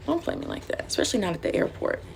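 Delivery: hushed, voiceless playful threat; mock whispered warning. Mock warning to the absent hostess.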